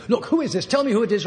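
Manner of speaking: angry demand. Retold or imitated. Ex-wife angrily demanding who is calling on the phone. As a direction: retell her irritated challenge down the phone. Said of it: "then she got angry".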